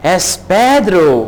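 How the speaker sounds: es Pedro drawn out slowly, each syllable clear; slow, careful es Pedro for repeating; slow model phrase. Shown as a demonstration. Teacher slowly models es Pedro for learners. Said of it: "expression है".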